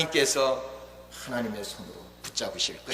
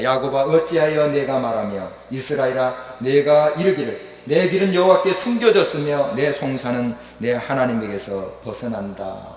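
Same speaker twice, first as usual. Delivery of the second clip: loud, slow, declaimed verse; scripture reading; 3 semitones higher, 8 dB louder than usual. Acted. Preacher reading verse twenty-seven to congregation, declaimed scripture reading. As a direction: proclaim the verse in measured phrases.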